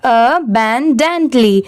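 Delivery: Abundantly drawn out slowly, syllable by syllable; slow model word. Shown as a demonstration. Teacher slowly models Abundantly so pupils can copy it. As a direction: stretch Abundantly out slowly for the class. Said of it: "इसे हम क्या pronounce करेंगे?".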